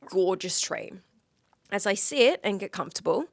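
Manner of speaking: ordinary talk, nothing marked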